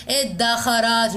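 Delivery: ادخرت drawn out slowly for the class to weigh; slow dictated word. Shown as a demonstration. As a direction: read ادخرت very slowly so students can parse it. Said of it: "نشوف بقى مين الشاطر". (نطق ادخرت ببطء شديد للطلاب)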